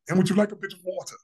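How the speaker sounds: high, quick imitated Australian accent. Guest imitating someone offering water, in a high Australian accent. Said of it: "give us your best Australian accent".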